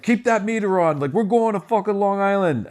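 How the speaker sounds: high, heated demand at the cabbie; angry yelling. Retold or imitated. Friend yelling at the cab driver, angry and high. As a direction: shout down the driver. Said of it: "yelling and fighting".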